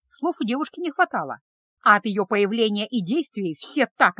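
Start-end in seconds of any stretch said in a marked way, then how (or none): none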